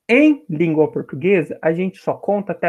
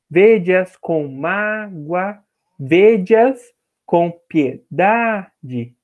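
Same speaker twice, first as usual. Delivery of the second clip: slow, measured syllables with long stresses; scanning the verse; much slower than usual. Shown as a demonstration. Teacher counting poetic syllables for students, slow separated scansion. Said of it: "Dez sílabas poéticas novamente".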